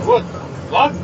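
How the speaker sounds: recalled bafflement, jumping up in pitch; incredulous reaction. Retold or imitated. Acted reaction to water prices.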